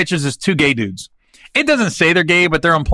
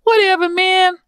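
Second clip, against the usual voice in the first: much higher, breathy-soft mock mumble; lazy high-pitched buddy impression; teasing a soft-spoken friend's voice; imitating Brad's soft voice; slower than usual. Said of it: "He, he talks like this".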